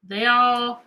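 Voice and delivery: normal talking voice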